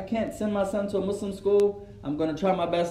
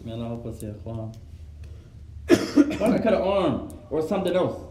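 normal talking voice

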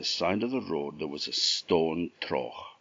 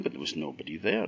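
ordinary delivery, nothing marked

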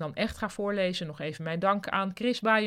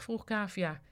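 ordinary delivery, nothing marked

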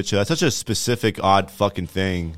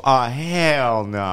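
sassy dismissal; 5 semitones higher, 3 dB louder, much slower than usual. Acted. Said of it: "just to watch David and go".

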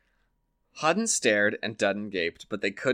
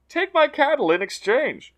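lively higher voices for Donald and the farmer, trading lines; storyteller's character voices; 13 semitones higher, 4 dB louder than usual. Acted. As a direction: play the Irish tale's quarrelling characters with a raised voice. The farmer pleads.

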